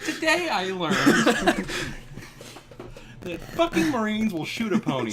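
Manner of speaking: finishing the friendship report with a grim lesson; mock pony lesson. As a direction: chirp out the moral like a pony pupil. Parodying pony's lesson letter.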